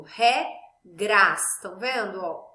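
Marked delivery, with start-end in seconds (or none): 0.0-1.4 cada sílaba dita à parte, mais alto; sílabas separadas. Shown as a demonstration. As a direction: separe re e gras com força. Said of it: "Por exemplo". (sílabas destacadas em voz alta)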